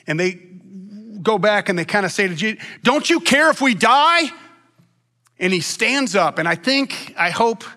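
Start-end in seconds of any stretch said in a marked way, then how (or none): 2.8-4.3 loud, high, alarmed cry; hammed-up desperate protest; acted panicked plea. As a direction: cry out like a frightened disciple in a storm. Acting disciples panicking in the storm.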